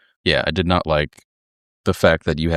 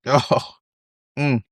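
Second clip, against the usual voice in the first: ordinary talk, nothing marked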